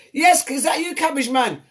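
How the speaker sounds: high, loud delighted call; excited greeting. Real, not acted. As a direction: greet the joker with glee. Host greets a teasing caller.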